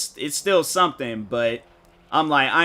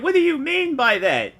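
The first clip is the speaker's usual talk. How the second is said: indignant shout; 13 semitones higher, 4 dB louder than usual. Retold or imitated. Indignant retort to "real world.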